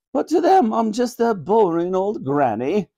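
a monarch mildly bemoaning her grandchildren; confiding Queen. Acted. Queen telling Granny how grandchildren see her, ruefully.

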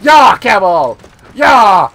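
loud whooping yells; cheering shout. Real, not acted. Player cheering his camel into the woods, loud shout. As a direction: urge the camel on at full voice.

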